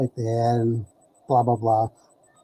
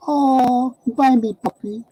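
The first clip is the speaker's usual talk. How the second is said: cooing plea; 10 semitones higher, slower than usual. Retold or imitated. Girlfriend begging for a puppy.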